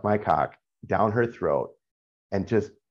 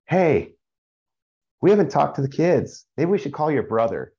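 imitating wife; 7 semitones higher than usual. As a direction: mimic her sudden worried question, mildly. Husband voices wife interrupting sex.

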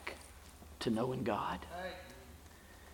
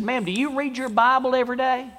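put-on high, louder little-boy voice; little boy's insistent question; 11 semitones higher, 6 dB louder than usual. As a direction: press the question like an earnest child. Joke voicing a little boy's question.

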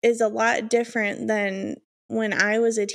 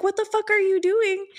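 put-on high-pitched disbelief at the kids; incredulous retold outburst; retold scolding; 8 semitones higher than usual. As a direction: ask it high, appalled. Aunt scolding dancing kids, retold.

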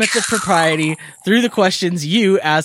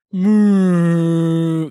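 cow moo. Retold or imitated. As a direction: moo like a snoring dad. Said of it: "it sounded like".

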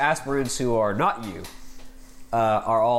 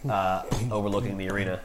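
ordinary talk, nothing marked

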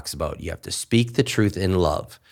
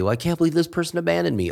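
higher, fast whiny mock lament; mock complainer; 10 semitones higher, much faster than usual. Mimicking someone who feels abandoned, whiny. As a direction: rattle off a self-pitying gripe.

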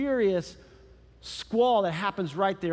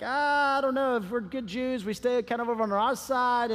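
reluctant excuse; 4 semitones higher than usual. Acted. Disciples' reluctance acted out.

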